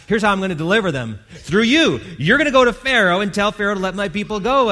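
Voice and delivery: high, loud, fast commanding call; booming voice of God. Acted. God commanding Moses, loud and rising. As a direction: proclaim it like God sending Moses.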